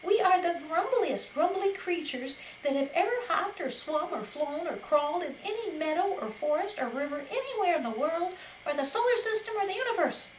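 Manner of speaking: a hammed-up furious rabbit tirade; shouted rant. A narrator's reading. Pretty insulting the wolf, building loud rant. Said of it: "she shouted at the top of her voice".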